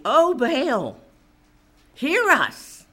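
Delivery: mock plea to an idol; crying out to Baal. Preacher imitates Baal's prophets praying, crying out. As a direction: call out as if imitating worshippers. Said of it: "they were crying out to Baal".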